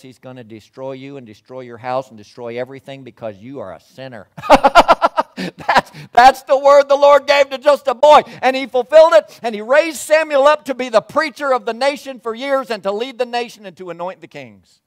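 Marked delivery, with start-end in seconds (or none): 4.3-5.3 high loud burst of laughter. Real, not acted. Preacher to congregation, laughing loudly. 5.4-14.8 much higher and louder preacher's cry; full-force preaching. Real, not acted. Preacher to congregation, preaching at full force. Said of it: "preaching at full force".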